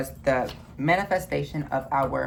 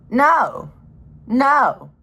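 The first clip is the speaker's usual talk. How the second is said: loud no; 7 semitones higher, 6 dB louder than usual. Real, not acted. Emphatic denial.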